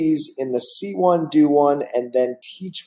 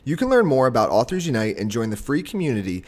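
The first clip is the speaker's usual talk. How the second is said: polished sponsor read with music under it; podcast ad read. Acted. Host reads the sponsor spot in an ad voice. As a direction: read it like a recorded podcast intro.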